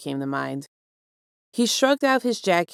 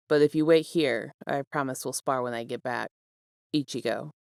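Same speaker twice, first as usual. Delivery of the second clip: lightly voiced note, a bit lower; a friend's hurried note, lightly voiced and a touch lower; note writer's voice; 4 semitones lower than usual. Reads the note aloud.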